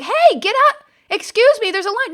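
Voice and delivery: high, loud protest from the queue; crowd calling out. Retold or imitated. People in line to the man in the cab, indignant call. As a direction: imitate onlookers hollering at a line-cutter. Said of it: "And everybody start like".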